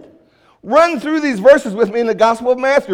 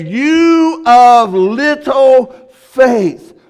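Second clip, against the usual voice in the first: very slow, louder, each word stretched; drawn-out rebuke; 3 semitones higher, 6 dB louder, much slower than usual. Acted. The Lord rebuking sinking Peter, slow and louder. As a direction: drag out you of little faith in reproach.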